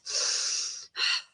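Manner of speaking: soft, breathy long exhale; sighing out the breath; deep-breath sigh. Shown as a demonstration. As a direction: release a deep breath audibly. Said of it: "Deep breath".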